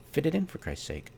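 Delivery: high, fed-up outburst; exasperated. Real, not acted. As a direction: throw up your hands at it. Exasperated rant at bad fit.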